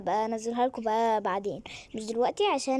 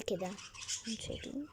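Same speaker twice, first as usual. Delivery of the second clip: whisper; 3 semitones lower, 14 dB quieter, faster than usual. Real, not acted. Showing night scene hushed.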